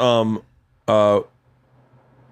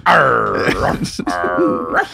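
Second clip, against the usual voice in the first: drawn-out British-dog growl; 6 semitones lower, much slower than usual. Acted. Comic showing how British dogs bark, drawn-out growl. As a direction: bark out long piratical arrs. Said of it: "and then British dogs are like".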